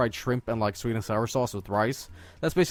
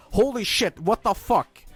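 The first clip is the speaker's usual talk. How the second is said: much higher, exasperated cry; angry outburst; 9 semitones higher, 3 dB louder than usual. Retold or imitated. Son receiving a second well-done steak, outraged and much higher. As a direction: blurt it out in disbelief. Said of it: "And I go".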